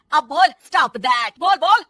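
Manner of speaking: loud high taunting shout; shouted dare. Acted. Mother-in-law shouts a dare at daughter-in-law, loud. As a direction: yell the dare at her.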